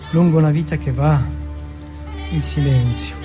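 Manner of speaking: ordinary talk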